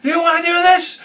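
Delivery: angry dad. Retold or imitated. Father flipping out at kids.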